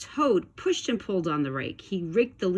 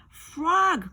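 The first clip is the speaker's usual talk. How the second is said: high, louder call of a name; 11 semitones higher, 4 dB louder than usual. A narrator's reading. Toad under the leaves calling for Frog, high call. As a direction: call into the woods for your friend. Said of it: "cried the thing".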